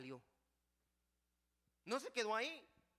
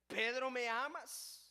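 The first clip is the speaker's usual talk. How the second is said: louder, slow question with weight on Pedro; insistent question; 5 semitones higher, 7 dB louder, slower than usual. Acted. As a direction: press him with the question. The Lord asks Pedro again. (el Señor insiste ante Pedro, fuerte y lento)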